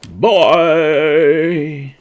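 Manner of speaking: loud, high, long-held farewell; shouted goodbye. Pretend. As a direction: holler a showy exit. Mock exit, called out.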